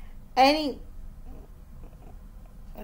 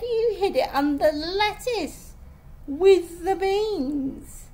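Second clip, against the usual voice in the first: high, raised telltale voice; accusing; 7 semitones higher, 3 dB louder than usual. A narrator's reading. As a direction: expose the trick, slowing at the end. Friend exposes her hiding food.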